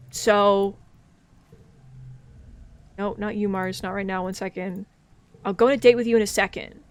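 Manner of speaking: ordinary talk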